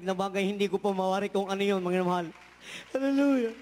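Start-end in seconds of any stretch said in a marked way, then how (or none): 2.9-3.5 high, loud ecstatic praise cry; a sudden joyful hallelujah; fervent hallelujah. Real, not acted. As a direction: lift it up like a shout of praise. Testimony exclamation in church.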